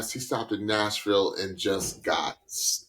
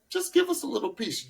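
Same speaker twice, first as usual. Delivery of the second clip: high demo; 12 semitones higher than usual. Shown as a demonstration. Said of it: "somebody said they like the growl".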